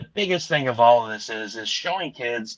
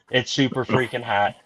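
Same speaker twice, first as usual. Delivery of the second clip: usual speaking voice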